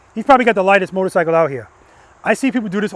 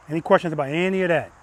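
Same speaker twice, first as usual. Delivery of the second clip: ordinary talk, nothing marked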